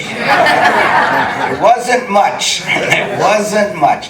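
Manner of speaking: real laughter, slow and drawn out; laughing. Real, not acted. Laughing to the interviewer about his tiny pay, real laughter. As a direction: laugh the words out slowly.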